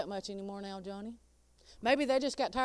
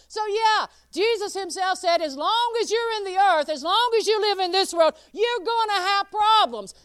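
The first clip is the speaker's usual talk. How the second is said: louder and higher, building preacher's cry; full-force preaching; 10 semitones higher, 9 dB louder than usual. Acted. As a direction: preach it out to the whole church.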